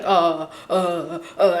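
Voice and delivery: imitating a man stalling on low filler sounds; mock dithering. Mimicking him beating around the bush, mock dithering. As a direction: stammer hesitantly, mocking his indecision. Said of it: "He can't even, like, say anything with, like, straight directness".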